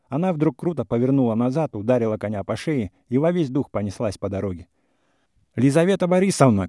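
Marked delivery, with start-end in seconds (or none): 5.5-6.7 high, loud call after her. A narrator's reading. He chases her on horseback, loud high call. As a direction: shout her name as she rides off. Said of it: "кричал он во всю прыть".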